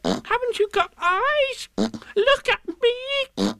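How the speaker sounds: very high, drawn-out squeal; slow, piercing little animal cry; shouting Piglet. Acted. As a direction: plead in a frantic squeak. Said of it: "shouted Piglet".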